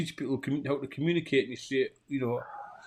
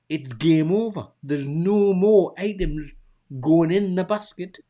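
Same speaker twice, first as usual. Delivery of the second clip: louder, higher, slowed rant; exasperated rant; 4 semitones higher, 5 dB louder than usual. Real, not acted. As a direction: insist it's over, you're out the store. Ranting about wife's late texts.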